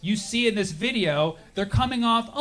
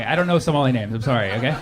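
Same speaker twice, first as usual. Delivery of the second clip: ordinary talk, nothing marked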